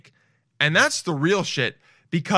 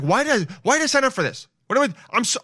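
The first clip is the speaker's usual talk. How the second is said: higher-pitched frustrated self-scolding; mock frustrated rant; 10 semitones higher, faster than usual. Picturing himself on the baking show, getting frustrated. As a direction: whine at yourself for signing up. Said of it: "I would just get mad".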